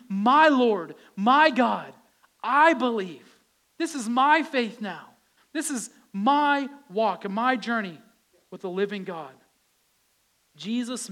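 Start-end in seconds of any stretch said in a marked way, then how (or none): 0.1-9.3 fervent declaration. Acted. Preached confession of faith.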